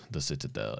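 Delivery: low echo of title. Real, not acted. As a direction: drop it down low and hushed. Repeats title low and soft.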